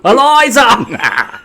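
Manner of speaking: stretched slow yell with a laugh; Nigel Thornberry shout. Pretend. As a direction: bellow the name like the cartoon explorer.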